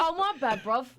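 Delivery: imitated British line. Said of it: "He says".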